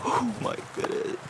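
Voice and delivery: high, hushed, breath-filled tearful voice; real crying. Real, not acted. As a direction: barely get it out, slow and overcome. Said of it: "I'm sorry for crying".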